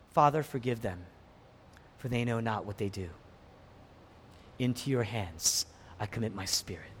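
low, soft, solemn plea; hushed prayer. Acted. Preacher voices Jesus praying on the cross, hushed prayer. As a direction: let it sink into quiet surrender.

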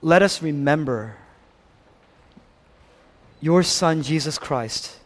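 ordinary talk, nothing marked

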